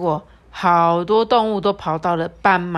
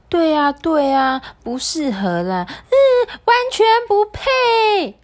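high mocking animal voice; 10 semitones higher, 6 dB louder, slower than usual. Dream animals mock zebra.

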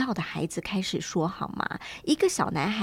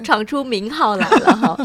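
laughing while teasing, louder; laughing tease; 8 dB louder than usual. Real, not acted. Host teasing the guest about her new name, laughing.

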